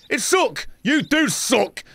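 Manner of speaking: high, slow teasing jeer; mock taunt. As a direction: drag out the insult. Mocking a game character.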